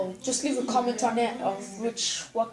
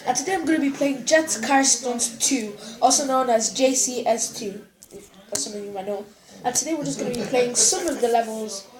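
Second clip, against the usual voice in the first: loud, bright host intro voice; YouTuber intro; 5 dB louder than usual. Acted. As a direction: announce it like a channel host.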